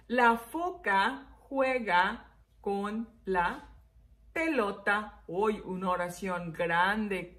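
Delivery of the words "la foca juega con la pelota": word by word with pauses; slow dictation. Shown as a demonstration. Teacher dictating a sentence to write, slow word by word. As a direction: dictate it slowly, separating every word. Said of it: "vamos a escribir".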